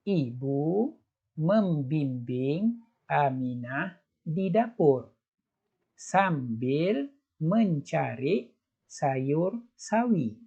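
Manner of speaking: slow, careful read-aloud; slow teacher read-aloud; slow model reading. Shown as a demonstration. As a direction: read it slowly so children can follow. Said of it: "cuba baca sekali lagi".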